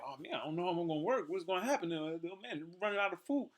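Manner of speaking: mock worried person.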